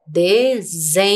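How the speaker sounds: "Desempenho" slowly, lingering on the ZEM; highlighted second syllable. Shown as a demonstration. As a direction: sound out "Desempenho" by syllables, holding the buzzing second one. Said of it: "A sílaba do S é a segunda".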